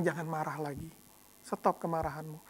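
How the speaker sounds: God quietly telling the angry man to stop, gently; gentle divine voice. Acted. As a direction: speak as God, softly telling him to stop. God gently rebukes anger.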